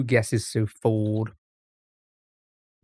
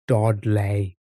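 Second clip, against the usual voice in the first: slower Dudley accent; 3 semitones lower than usual. Shown as a demonstration. Streamer retrying the town name, slower Dudley accent.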